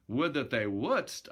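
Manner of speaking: usual speaking voice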